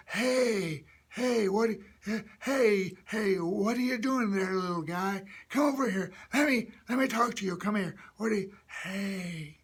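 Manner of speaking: put-on high, airy elderly call; old person. Shown as a demonstration. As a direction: tight throat, lots of air, like an old-timer. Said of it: "make an old person's voice".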